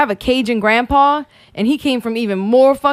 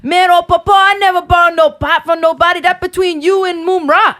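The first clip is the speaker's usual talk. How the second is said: imitating a Cajun cartoon voice; 7 semitones higher than usual. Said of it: "I grew up".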